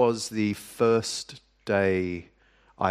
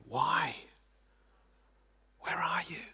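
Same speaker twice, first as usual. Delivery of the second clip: hushed cry; 7 semitones higher, 7 dB quieter than usual. Retold or imitated. Quoting the believer's cry to God.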